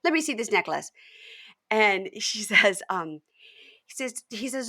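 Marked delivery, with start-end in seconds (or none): 0.0-0.9 high, rapid-fire imitated interrogation; mock fixated questioning. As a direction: fire off questions, getting louder, as if obsessed. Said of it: "all she could focus on".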